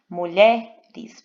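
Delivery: mulheres split as mu-LHE-res, LHE punched loud; stress on penultimate syllable. Shown as a demonstration. Teacher proving it is paroxytone, mulheres with loud LHE. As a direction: hit LHE in mulheres, then a separate res. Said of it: "paroxítona".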